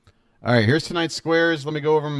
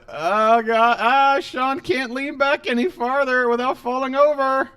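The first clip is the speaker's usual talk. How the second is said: excited; 10 semitones higher than usual. Real, not acted. Reacting to Sean leaning back.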